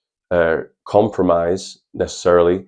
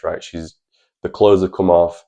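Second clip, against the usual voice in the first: ordinary talk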